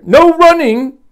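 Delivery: loud, high scolding shout; calling after. Acted. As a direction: holler the rule after the runner. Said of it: "Miss Merriweather called after him".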